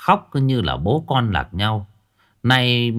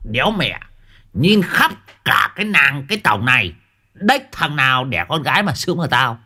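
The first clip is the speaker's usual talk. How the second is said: 1 boastful glee; 6 semitones higher, 3 dB louder than usual. A narrator's reading. Old man boasting to friends.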